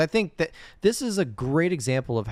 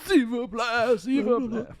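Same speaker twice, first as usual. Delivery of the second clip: pleading French mutter; 13 semitones higher, slower than usual. Retold or imitated. Imitates Mac muttering French.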